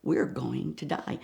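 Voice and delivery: soft, low whispered threat; whispered threat to friends; menacing whisper. Retold or imitated. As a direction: hiss it under your breath. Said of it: "I said to them".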